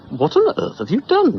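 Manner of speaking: high, loud alarmed cry; a mother yelling in alarm; alarmed shout. Acted. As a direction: call out in shock through the door.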